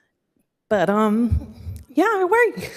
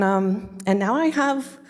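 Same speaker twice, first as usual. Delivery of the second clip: normal talking voice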